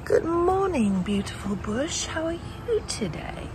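high, slow cooing hello; cooing at a plant. Acted. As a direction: greet the bush like a baby. Greets a bush sweetly.

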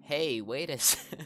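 put-on high, loud, slow girly cry with a laugh; a laughing girl calling out to wait; hammed-up [name] shout. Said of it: "I'm not doing voice acting".

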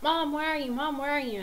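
high, plaintive child imitation; child calling for Mom. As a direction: mimic a waking child calling out. Waking child calls for mother.

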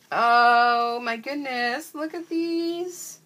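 drawn-out delighted amazement; amazed delight. Real, not acted. Amazed reaction to new stickers.

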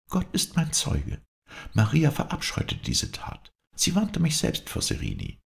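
high-pitched, impassioned protest; vehement. A narrator's reading. Viola testifies for Maria's innocence, vehemently. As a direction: swear it urgently, pitched high. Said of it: "entgegnete Viola heftig".

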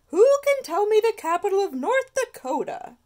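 high, loud schoolteacher voice; teacher voice. Acted. Miss Finnegan quizzing the geography class, high and loud. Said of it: "asked Miss Finnegan".

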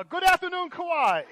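very loud, high mock TV anchor greeting; TV morning host. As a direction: play a morning show host opening the broadcast. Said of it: "I've always wanted to be on Good Morning America".

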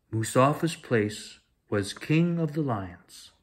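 usual speaking voice

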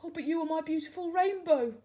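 cling to her with this line; pleading tadpole. Acted.

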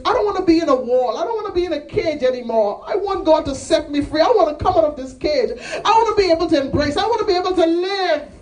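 high, urgent preacher's cry; impassioned plea. Acted. Acts out impassioned plea for freedom.

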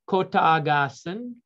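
normal talking voice

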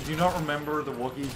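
normal talking voice

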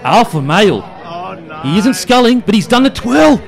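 mock play-by-play building to a yell; excited sports commentary. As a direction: call it like a grand final moment, pitch climbing. Mock sports commentary of beer carry.

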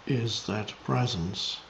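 meditative teaching, measured and spacious; contemplative spiritual guidance. Real, not acted. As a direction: guide listeners into presence, unhurried.

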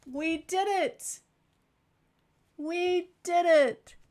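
loud, high, slow triumph; excited cheer. Acted. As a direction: celebrate like a win. Trick succeeded.

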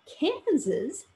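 high indignant rise on Kansas; Dorothy bristling that her tree is compared to Kansas; a touch of offended disbelief. A narrator's reading. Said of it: "said Dorothy indignantly".

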